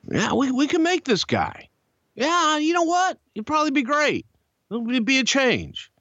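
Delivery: high strained impression of a boss persuaded; put-on high voice, growing keen; Vince's voice. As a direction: imitate someone talking himself into it, pitched far up. Vince warms to the idea.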